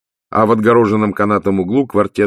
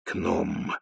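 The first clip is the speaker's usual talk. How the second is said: wheezed aside; 11 dB quieter than usual. A narrator's reading. Vimes quietly correcting his wife, wheezy. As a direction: wheeze it under your breath. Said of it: "просипел Ваймс".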